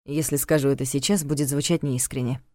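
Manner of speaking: calm. A narrator's reading. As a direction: answer evenly, unhurried. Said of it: "спокойно ответил Валян".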